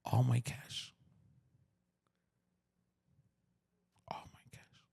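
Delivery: hushed, half-whispered, with long pauses; emotional. Real, not acted. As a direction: let the voice fade nearly to breath. Moved recalling nearly losing the cash.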